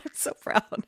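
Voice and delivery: soft, voiceless echo of pride; hushed pride. Retold or imitated. Telling of a kid hooked on Fork videos, whispering proudly. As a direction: overcome with mock emotion, dropping to a whisper.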